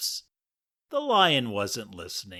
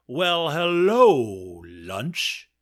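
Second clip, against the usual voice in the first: slow, drawn-out predator greeting, louder; lion; 3 semitones higher, 7 dB louder, much slower than usual. Acted. Lion greeting the mouse he plans to eat, slow and loud. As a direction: savour the word as if the mouse is food. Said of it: "said the lion".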